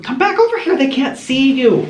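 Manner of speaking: high sweet voice to a kitten. Real, not acted. Calling kitten in baby voice.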